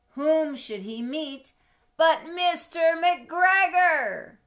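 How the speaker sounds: slow dramatic climb to the name; suspenseful reveal. A narrator's reading. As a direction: draw it out with rising suspense.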